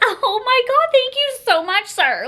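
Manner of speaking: high, excited thank-you; gushing thanks. Retold or imitated. To the cashier, high gushing thanks. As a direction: overflow with gratitude. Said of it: "I'm like".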